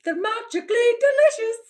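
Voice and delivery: high, loud, quick mock-Irish catchphrase; cartoonish Irish accent, pitched right up; attempted Irish accent. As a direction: do a hammed-up Irish leprechaun. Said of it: "I don't really have an Irish accent".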